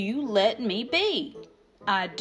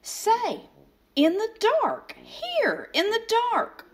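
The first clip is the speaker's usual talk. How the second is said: much higher, pleading Sam voice; coaxing Sam; 10 semitones higher than usual. Acted. Sam coaxing the grump to eat in the dark, high wheedling voice. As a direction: wheedle like a persistent kid.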